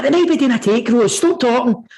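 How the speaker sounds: high, mock stern granddad telling her off; serious granddad. Granddad tells granny to stop talking, mock stern. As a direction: hush her like a know-all granddad. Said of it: "He's like".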